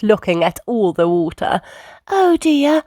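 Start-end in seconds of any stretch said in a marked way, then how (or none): 2.1-2.8 high storybook mum voice; fretting mother, pitched up; Mummy Pig. A narrator's reading. Mum seeing the flood, high worried voice. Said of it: "Mummy Pig said".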